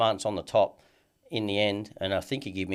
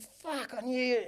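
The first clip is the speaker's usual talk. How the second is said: high, softer retold exclamation; retold surprise, pitched way up; startled recognition; 4 dB quieter, slower than usual. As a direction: imitate someone suddenly placing a face. Old acquaintance recognises him, retold.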